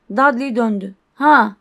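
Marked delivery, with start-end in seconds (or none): 1.1-1.6 grunt. Acted. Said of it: "diye omurdandı".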